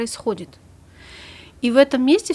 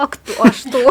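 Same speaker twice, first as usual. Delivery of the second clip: louder, higher rapid curious questions; eager questioning; 4 semitones higher, 11 dB louder, slower than usual. Retold or imitated. Therapist acts out her own reflexive curiosity when hearing a story. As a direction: jump in like a nosy detective. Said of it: "начинаю к ней относиться не как к гипотетически".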